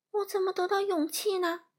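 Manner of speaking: high, plaintive question; worried Lion. A narrator's reading. Lion asking about his courage, high and worried.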